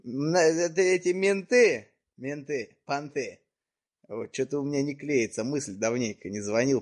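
ordinary talk, nothing marked

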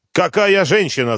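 high, loud frantic yell; father yelling at his mother over the phone. Acted. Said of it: "заорал я".

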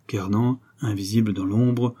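ordinary delivery

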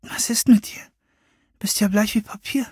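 leise, hoch geflüstert; besorgtes Flüstern. Acted. Besorgtes Flüstern im dunklen Zimmer. (Madonna fragt die blasse Freundin flüsternd)

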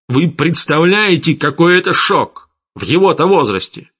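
put-on father raging at attackers of his boy, loud and high; furious tirade. A narrator's reading. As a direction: berate them, climbing in fury. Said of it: "рявкнул Гессер".

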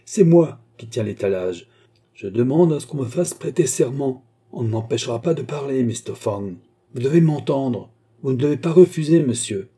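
insistent crying out. A narrator's reading. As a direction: insist loudly, refusing to be silenced. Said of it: "criait l'homme". (protestation véhémente, voix haussée)

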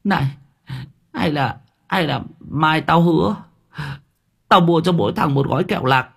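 whimpering plea. Acted. Quân bargaining with roommates, whining and near tears. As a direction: plead like a frightened kid. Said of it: "mếu máo".

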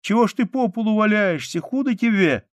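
put-on high, loud, alarmed little girl; child's urgent shouting; crying out. A narrator's reading. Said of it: "закричала она".

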